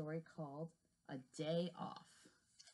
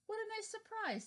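delight pitched high. Acted. Worm thanks friend for the tunnel, high delighted. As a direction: sound truly charmed by the gift.

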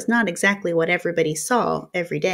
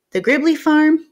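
higher, louder call over the shoulder; calling back; 8 semitones higher, 6 dB louder than usual. Acted. Boy calling back from the door, higher and louder. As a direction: shout it back as he leaves.

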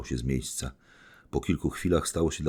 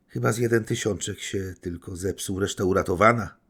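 fawning; 5 semitones higher, 4 dB louder than usual. Acted. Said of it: "powiedział, przymilając się".

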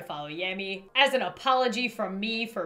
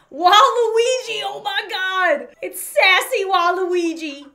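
loud, high, drawn-out exclamation; real excited surprise; 8 semitones higher, 9 dB louder, slower than usual. Real, not acted. Player spotting sassy Waluigi, thrilled exclamation. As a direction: cry out slowly and high with delight.

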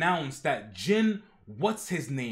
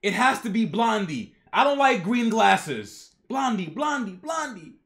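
mock tantrum; 5 semitones higher, 5 dB louder than usual. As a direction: demand it like a spoiled child. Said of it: "He's having a tantrum".

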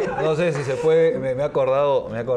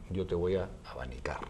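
hushed witch doctor; 5 semitones lower, 7 dB quieter than usual. Acted. Witch doctor whispered line.

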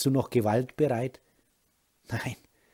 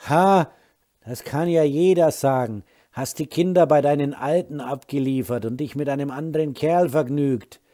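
put-on jealous drunk rage; 4 semitones higher, 7 dB louder than usual. Drunk husband accuses wife.

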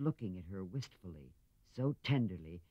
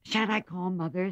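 troubled; 9 semitones higher, 4 dB louder than usual. A narrator's reading. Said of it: "much troubled".

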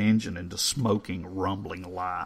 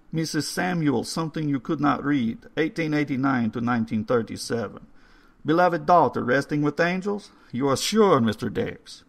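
put-on higher, louder old foreign gentleman; old caretaker voice; 6 semitones higher, 4 dB louder than usual. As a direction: read the stone like a courteous old man. Old caretaker reads the gravestone.

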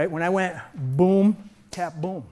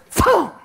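sharp high shouted impact noise; punchy sound effect for a strike; shouted thump; 12 semitones higher, 6 dB louder than usual. Shown as a demonstration. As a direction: bark out the hit sound. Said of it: "This could be me going forward".

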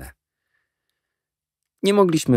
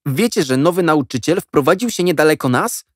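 put-on eager boy; 8 semitones higher, 3 dB louder than usual. A narrator's reading. Jack reveals news.

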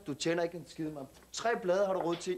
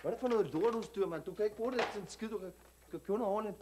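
put-on street slang Danish accent, cocky; perkerdansk accent. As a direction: talk like a tough kid from the suburbs, perkerdansk accent. Same immigrant youth character. (selvsikker perkerdansk accent)

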